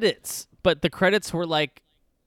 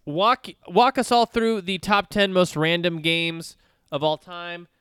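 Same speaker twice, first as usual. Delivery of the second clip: show host intro; 6 semitones higher, 4 dB louder than usual. Acted.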